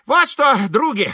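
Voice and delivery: high, animated, declaiming Ibrahim; Ибрагим. A narrator's reading. Ибрагим обращается к соавторам. (высокий приподнятый голос Ибрагима)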